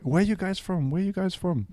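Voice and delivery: put-on high, Italian-accented questioning; Italian bartender. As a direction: imitate a curious Italian barman. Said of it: "And then he was like".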